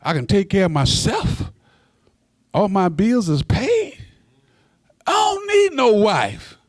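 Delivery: high, indignant, rising protest; hammed-up boastful younger self; stubborn young man. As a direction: insist you need nobody. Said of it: "I said".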